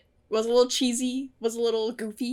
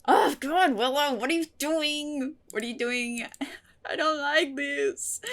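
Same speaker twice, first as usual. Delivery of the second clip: upset; 4 semitones higher than usual. Real, not acted. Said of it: "Very, very upset".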